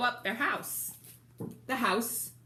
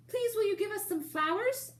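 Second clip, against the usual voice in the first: much higher little wolf voices, polite; squeaky little wolves asking; 14 semitones higher than usual. Acted. Little wolves politely begging the rhinoceros driver, high and squeaky. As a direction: ask sweetly in a tiny high voice.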